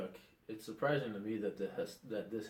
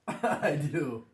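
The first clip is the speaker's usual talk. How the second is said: laughing; 6 semitones higher, 13 dB louder than usual. Real, not acted. Laughing while sworn in.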